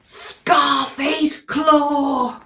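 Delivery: very slow, loud, drawn-out cry; dramatic announcement. Acted. Reading the villain's name to a child, big slow shout.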